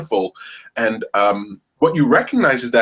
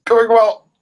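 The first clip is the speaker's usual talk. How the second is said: high, loud, strained on held breath; holding breath; 9 semitones higher, 7 dB louder than usual. Real, not acted. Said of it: "hold your breath with me".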